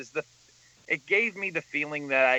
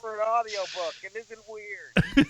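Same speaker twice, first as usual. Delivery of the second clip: high, defensive whine; pleading mock husband, stretching it out; 7 semitones higher, 4 dB louder than usual. Acting the husband defending himself.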